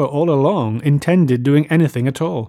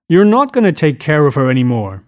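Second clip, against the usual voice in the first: louder, slightly panicked protest; touch of panic; 2 semitones higher, 7 dB louder than usual. A narrator's reading. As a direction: push back with mild alarm. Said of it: "with a touch of panic".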